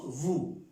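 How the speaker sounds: "vous" with a V where B belongs; mistaken V sound. Shown as a demonstration. Teacher warns learners off the wrong sound, V in "vous". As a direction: show the wrong opening V in "vous".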